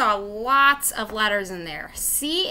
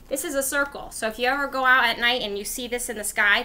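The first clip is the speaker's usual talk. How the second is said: ordinary delivery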